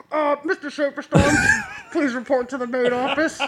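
high, laughing office announcement; intercom announcement. Pretend. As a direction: read it like a school PA. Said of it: "and it's like".

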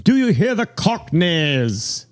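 a lightly voiced proud Londoner calling out high and slow; crying out citizen. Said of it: "cried out".

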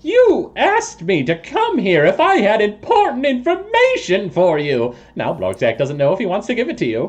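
high-pitched, huffy toad voice; affronted toad creature. Acted. Toad person scolds those who summoned him, high and huffy. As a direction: start high and indignant, then quicken into a sulk.

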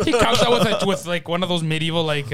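ordinary talk

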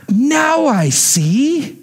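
high, very drawn-out imitated revelation; stretched, wonderstruck kid impression; Lulu. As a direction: act a child's amazed dawning understanding. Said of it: "That was exactly what she said".

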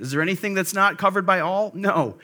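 usual speaking voice

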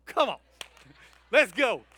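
loud, rising Saints cheer; cheering football fan. Retold or imitated. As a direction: whoop it up for the team.

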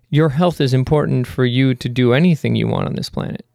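ordinary talk, nothing marked

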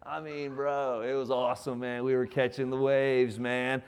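laid-back surfer dude; lightly voiced surfer dude. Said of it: "the surfer dude, he's like".

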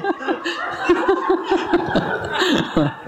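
long high-pitched laughing fit; high helpless laugh. Real, not acted. Congregation member cracking up at his own story, high laugh. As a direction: let the laugh run up high.

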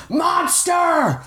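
high, far-off angry crowd yell; a militiaman shouting at Alphonse. A narrator's reading. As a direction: hurl the insult from the charging mob. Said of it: "clear shouts of angry voices".

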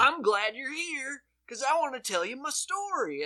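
put-on cozy up to the officer like a drunk; mock drunk suspect voice; drunk guy on Cops. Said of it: "a drunk fucking guy on cops is like".

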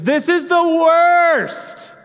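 aching lament rising high and loud at first; Job lamenting. Acted. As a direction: cry it out like a worn-out sufferer, then steady yourself. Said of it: "He sits there and he goes".

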